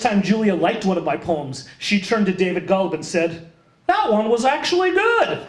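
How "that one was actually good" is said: very high-pitched imitation; mock astonished verdict, pitched way up; very audible aside. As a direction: pipe it up high for the whole room to hear. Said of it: "very audibly".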